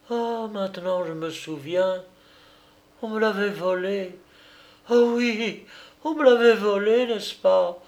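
high, trembling realisation; dawning relief after madness; overwhelmed mother. Acted. Mother realising her baby was stolen. (mère bouleversée, voix haute)

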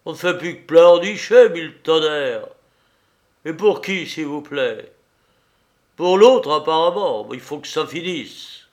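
cris rageurs, voix plus haute et forte; tempête contre la femme en pleurs; marin en colère. A narrator's reading. (marin furieux qui tempête)

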